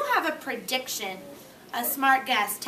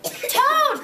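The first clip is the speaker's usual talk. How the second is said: high, loud calling shout; 12 semitones higher, 7 dB louder than usual. Acted. Frog shouting at Toad's door, loud calling. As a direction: yell for Toad to come out. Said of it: "shouted Frog".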